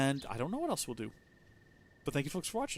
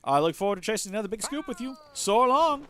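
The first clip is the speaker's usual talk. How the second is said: animated, higher character voice; enthusiastic reporter partner; 9 semitones higher, 7 dB louder than usual. Acted. Newspaper reporter congratulating a partner on an investigation, enthusiastic. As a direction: hail your partner loudly, then chat eagerly.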